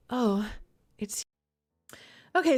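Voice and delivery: ordinary talk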